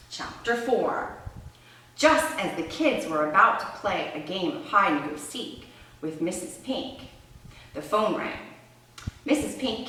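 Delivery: put-on lively storybook read-aloud with questions to the kids; animated picture-book reading, soft asides; reading to children. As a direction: read it like story time for little ones. Teacher reads story to children.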